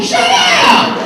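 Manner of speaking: high-pitched comic yell; outburst. Acted. Worker on being called in by the supervisor, comic outburst. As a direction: blurt it out sky-high.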